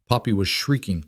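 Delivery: usual speaking voice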